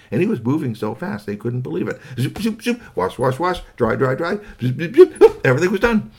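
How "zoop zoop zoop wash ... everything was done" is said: high, loud playful zoop zoop; zippy sound effects. A narrator's reading. Narrator to children, mimicking the robot's speed, high and loud. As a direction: rattle it off like a speeding machine.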